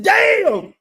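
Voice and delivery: very high, loud disbelief; incredulous high-pitched holler; astonished shout. Retold or imitated. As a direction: yell it in squealing amazement. Astonished reaction in retold exchange.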